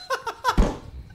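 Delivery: short high-pitched laugh; high burst of laughter. Real, not acted. Laughing at the manager over the Blizzard sign, high burst of laughter. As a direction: break into a high squeal of a laugh.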